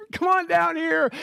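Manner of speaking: high-pitched call up a tree. Acted. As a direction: beckon a buddy down. Jesus calling man from tree.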